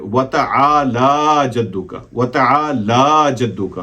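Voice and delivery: वतआला slow, with a long drawn laa. Shown as a demonstration. Teacher repeating correct prayer recitation, laa stretched long. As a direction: pull the la in वतआला out slowly. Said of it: "ला को थोड़ा सा लंबा करेंगे".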